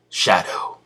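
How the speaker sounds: mock-dramatic whisper; hushed, whispered reveal; whispered name. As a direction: breathe the name out like a secret identity reveal. Dramatic self-naming of Shadow in retelling.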